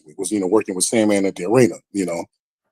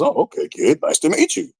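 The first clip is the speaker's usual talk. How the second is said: higher-pitched imitation of the boss; casual greeting in a put-on high voice; Vince McMahon; 7 semitones higher than usual. Big boss greeting a rookie, offhand imitation.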